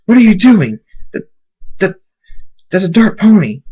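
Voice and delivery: high, loud outburst; alarmed protest. Acted. Firefly seeing a dark pony among them, alarmed high protest.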